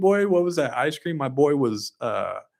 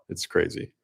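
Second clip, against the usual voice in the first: normal talking voice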